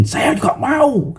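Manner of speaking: put-on high, slow childlike eagerness; eager wish. Disciples wanting to walk on water too, eager. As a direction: want it too, like a keen child. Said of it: "mereka juga pingin".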